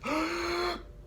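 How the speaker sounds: sharp high gasp in; big gasp. Shown as a demonstration. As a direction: suck in a giant breath. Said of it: "take in a giant breath".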